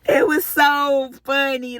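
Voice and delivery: high, loosened voice after the shriek; real amusement at the memory; amused outburst. Real, not acted. Laughing at her own retold panic.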